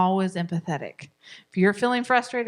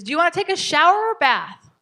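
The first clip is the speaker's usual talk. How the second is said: higher, louder parent offering a choice; bright parent voice, a touch louder; 8 semitones higher, 5 dB louder than usual. Shown as a demonstration. Parent asking child to pick shower or bath, higher and louder.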